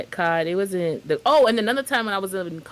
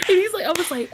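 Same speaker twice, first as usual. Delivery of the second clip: ordinary talk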